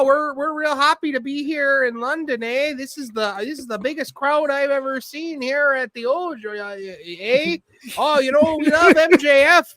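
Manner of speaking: high, slow, folksy Canadian drawl with eh; Canadian accent. Retold or imitated. Widow greeting the London crowd, mock Canadian accent. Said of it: "she was like".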